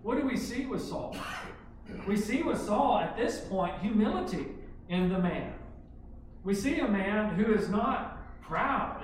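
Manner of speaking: loud, high, driving sermon voice; full-force preaching. Acted. Preacher proclaiming Saul's humility to the church, loud and driving. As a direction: hammer home each point to the congregation.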